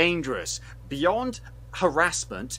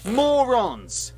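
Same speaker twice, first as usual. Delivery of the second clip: exasperated contempt; 5 semitones higher, 4 dB louder, slower than usual. Real, not acted. Insulting the film makers in anger.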